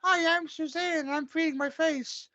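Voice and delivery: high, drawn-out mocking voice; mock Suzanne. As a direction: speak for her in a silly, slow falsetto. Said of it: "I gotta speak for her".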